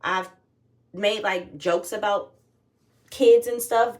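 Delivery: ordinary talk, nothing marked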